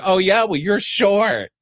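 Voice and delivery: put-on childish taunt; mock bickering voice. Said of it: "It's like".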